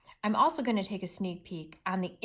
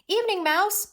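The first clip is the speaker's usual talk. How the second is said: loud, high greeting from a cross mole; annoyed storybook mole, pitched up and loud; angry mole; 12 semitones higher, 8 dB louder than usual. Acted. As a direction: greet the neighbor with raised voice. Angry mole's line in story.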